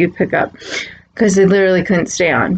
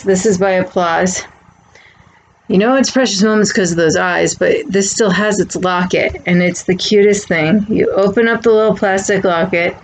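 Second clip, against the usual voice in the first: ordinary delivery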